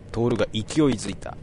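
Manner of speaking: ordinary delivery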